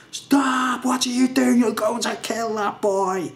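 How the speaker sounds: teacher shouting at the lads to stop; retold shout. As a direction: imitate the teacher yelling through the window. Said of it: "just shouting at everyone".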